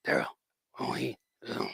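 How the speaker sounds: mimicking Daryl's grunting mumble; a hint of Daryl, grunted under the breath; Daryl grunts. Joking about the Walking Dead guy, a mild Daryl grunt. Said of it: "that's the way he talks".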